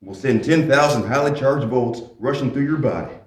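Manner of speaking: ordinary talk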